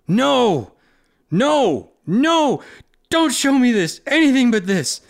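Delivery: high, loud repeated no's; anguished cries. Acted. Roan seeing her baby killed, anguished pleading. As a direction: beg the vision to stop. Character reliving child's death.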